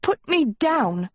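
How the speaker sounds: high, drawn-out indignant demand; flustered high-pitched protest; sputtered protest. A narrator's reading. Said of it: "she sputtered".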